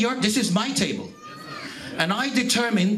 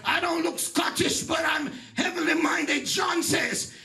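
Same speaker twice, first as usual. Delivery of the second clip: higher, shouted gospel preaching with slow stretched calls; preaching at full force; 5 semitones higher than usual. Acted. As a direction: call out to the congregation like a revival preacher. Preaching climax, seats for every nation.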